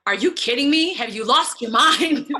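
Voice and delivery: exasperated manager; incredulous manager. Retold or imitated. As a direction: play the shocked manager. Business manager voiced in disbelief.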